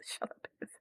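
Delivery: whispered aside, almost no voice; a quick unvoiced whisper telling Bev off. Real, not acted. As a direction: hiss it under your breath at chat. Aside to chat about Bev.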